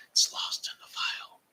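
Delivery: whispered to himself. Acted. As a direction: breathe it like a secret aside. Aside before repeating louder.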